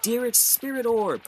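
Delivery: high, drawn-out anime move call; mock attack call. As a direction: announce the special move, stretched and high. Acts out calling an attack.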